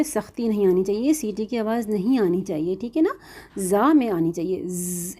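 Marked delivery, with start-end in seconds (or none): 4.6-5.2 ز with a soft whistling buzz, slow and quiet; hissing z demo. Shown as a demonstration. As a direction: show the sibilant of za. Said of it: "ایسے کر کے". (سیٹی والی آواز کے ساتھ ز)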